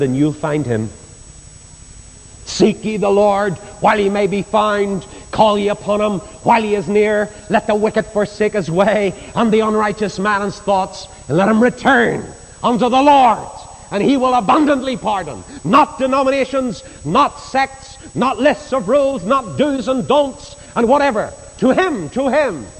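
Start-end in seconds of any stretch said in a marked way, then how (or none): 2.5-15.4 higher, lifted preaching of scripture; scripture recitation. Acted. Preacher calling the congregation to seek the Lord, proclaiming. 15.6-22.7 higher, ringing preacher's cry; fervent preaching. Acted. Preacher urging the flock to go to Him, fervent.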